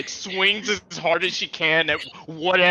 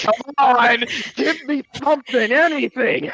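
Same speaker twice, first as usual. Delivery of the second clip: pleading; 7 semitones higher, 4 dB louder than usual. Real, not acted. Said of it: "pleading for a response".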